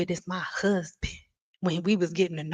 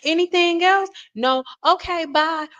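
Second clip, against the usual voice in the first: put-on high, sweet elderly auntie greeting; a fond imitation of a hundred-and-five-year-old aunt, high; 7 semitones higher, 4 dB louder than usual. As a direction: lift the voice up into a frail old auntie. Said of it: "she'll be like".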